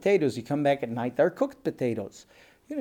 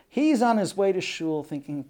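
usual speaking voice

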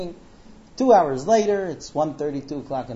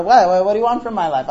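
loud, higher exasperated demand; exasperated protest; 4 semitones higher, 10 dB louder, faster than usual. Retold or imitated. Husband confronting wife who keeps waking him, exasperated. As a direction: burst out in frustration.

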